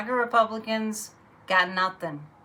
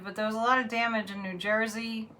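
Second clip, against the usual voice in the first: ordinary talk, nothing marked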